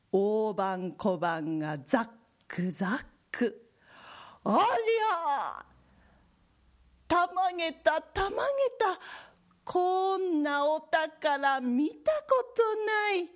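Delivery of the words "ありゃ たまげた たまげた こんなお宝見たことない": high, stretched-out astonishment; amazed. Acted. Old man finds gold coins.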